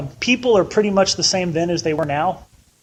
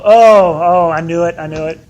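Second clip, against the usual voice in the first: mock panic; 8 dB louder than usual. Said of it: "and we go".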